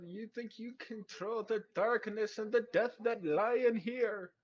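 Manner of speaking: high, loud, slow villain challenge; drawn-out, high-pitched ominous threat; childlike voice straining for menace. Acted. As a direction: play a child posing as a dark lord. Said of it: "the childlike voice almost, uh, tries to deepen itself".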